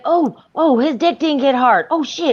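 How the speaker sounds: high, gawking neighbor; thrilled bystander watching a fight; excited. Retold or imitated. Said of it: "People looking out the window like".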